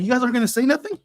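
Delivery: put-on high little-boy voice; high-pitched retold kid question; youngest son. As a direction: imitate an eight-year-old challenging his brothers. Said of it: "my youngest looks at them".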